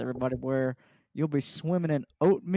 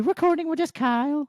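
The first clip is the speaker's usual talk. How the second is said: high imitating voice; 11 semitones higher, 3 dB louder than usual. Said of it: "she's like".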